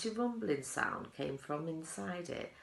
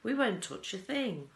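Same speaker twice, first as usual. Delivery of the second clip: put-on eager children; 6 semitones higher, 5 dB louder than usual. A narrator's reading. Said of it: "cried the children".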